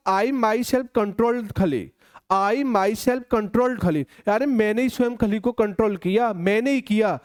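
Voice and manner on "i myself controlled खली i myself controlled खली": each word laid out very slowly, myself weighed; slow emphatic example. Shown as a demonstration. Teacher to students, showing the emphatic pronoun, very slow. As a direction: dictate the sentence slowly to the class. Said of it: "लेकिन मैंने बोला".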